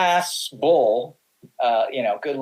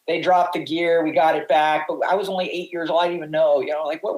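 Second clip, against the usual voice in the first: usual speaking voice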